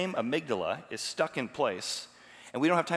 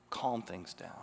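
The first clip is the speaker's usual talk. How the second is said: calm and soft; 5 semitones lower, 7 dB quieter, slower than usual. Shown as a demonstration. Preacher showing how a thermostat calms things, lower and softer.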